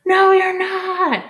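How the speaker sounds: long, high, loud "No, you're not."; yelled reply. Shown as a demonstration. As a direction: yell it back like a whole class. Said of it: "Say it, yell it, say it".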